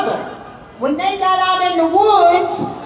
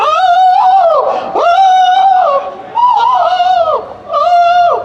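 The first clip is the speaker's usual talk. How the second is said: much higher, louder wailing oohs; wounded bear crying; 13 semitones higher, 4 dB louder, much slower than usual. Acted. Wounded father bear running off, high loud wailing howls. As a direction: howl out the pain as you run. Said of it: "ran off crying".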